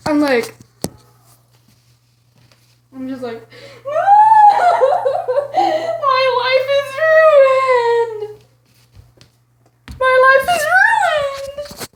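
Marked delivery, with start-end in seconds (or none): 3.6-5.5 high, loud stuttering boo-hoo; mock wailing sob. Acts out her sobbing breakdown. 5.6-11.9 loud, high, drawn-out wail; hammed-up sobbing. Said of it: "start sobbing".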